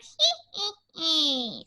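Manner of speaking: very high cackling "Hee, hee"; a witch's shrill cackle; witchy. Shown as a demonstration. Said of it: "We did some of the witchy".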